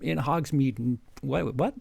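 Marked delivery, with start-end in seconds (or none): none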